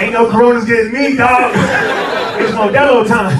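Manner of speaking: loud, cocky party bro; swaggering bro voice. Acted. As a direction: hype it up like a beer ad. Mock beer ad bravado.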